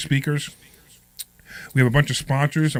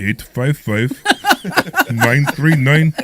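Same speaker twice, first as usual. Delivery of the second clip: Omri's sexy voice; 5 dB louder, much slower than usual. Pretend. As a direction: lay on the seductive accent. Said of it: "I gotta try to do Ami's voice".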